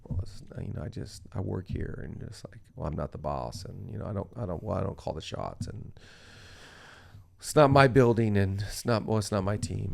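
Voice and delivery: lazy excuse-making. Retold or imitated. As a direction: sound like a worker dodging all responsibility. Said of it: "you'll start to live like".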